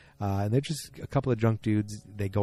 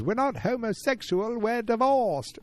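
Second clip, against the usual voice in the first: high-pitched mock yell of an indignant Brit, slowing on divorced; Lane impression; 14 semitones higher, slower than usual. Lane yelling at the comedian in the club, high-pitched mock yell. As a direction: squeal it out like an offended Lane. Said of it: "that was my best Lane yet".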